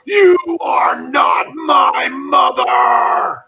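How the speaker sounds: high, drawn-out anguished yell; top-of-his-lungs scream. Retold or imitated. Father Karras finally cracks at the possessed Regan, screaming. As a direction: scream each word slowly, at breaking point. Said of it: "he screams at the top of his lungs".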